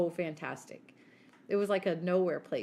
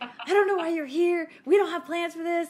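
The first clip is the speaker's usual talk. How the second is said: high, loud, scolding impression; shrill-free but loud mimicry of her yelling; mock angry yelling; 9 semitones higher, 8 dB louder than usual. Sister imitating the host yelling at her. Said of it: "she proceeds to yell at me".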